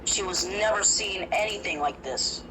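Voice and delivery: ordinary delivery, nothing marked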